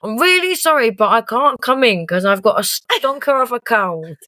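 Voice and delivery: high, slow fake-ill phone voice; hammed-up poorly voice to fool the boss; a partier faking a cold to his boss, mock sick voice; put-on sick voice. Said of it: "and then his voice went".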